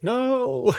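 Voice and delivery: a high, loud, despairing wail of "No"; anguished no. Retold or imitated. The runner slumped over, imitated with a loud, high despairing no. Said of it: "head between his knees, like".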